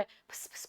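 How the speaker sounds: breathy unvoiced pss pss, no voice at all; whisper sound pss. Shown as a demonstration. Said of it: "whisper का sound क्या होता है".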